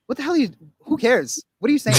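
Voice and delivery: a mock exasperated who-cares; mock dismissive. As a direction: scoff at it like it's nothing.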